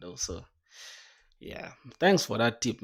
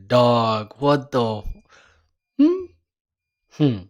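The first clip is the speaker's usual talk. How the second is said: laughing through the words; kind gentle laugh; 13 dB louder, slower than usual. Real, not acted. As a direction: burst out laughing, loud and real. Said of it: "I'm not laughing at you".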